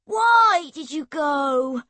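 loud, slow, high wail of a question; begging boy. A narrator's reading. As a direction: plead with a long, wailed WHY. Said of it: "Erg begged her".

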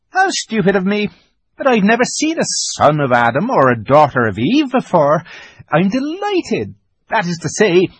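high, loud, excitable creature voice; flustered, delighted fawn. A narrator's reading. Fawn amazed to meet a human, high flustered delight.

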